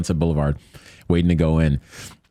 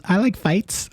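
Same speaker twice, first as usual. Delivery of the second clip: put-on girly voice; 14 semitones higher, slower than usual. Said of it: "She goes".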